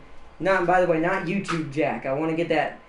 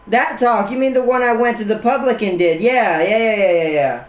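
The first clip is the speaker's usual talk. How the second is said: excited; 5 semitones higher, 7 dB louder than usual. Real, not acted. Excited recognition.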